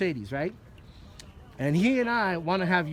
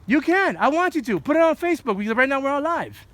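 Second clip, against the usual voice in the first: defiant shouting; 6 semitones higher, 6 dB louder than usual. Real, not acted. Defiant at security, livestreaming.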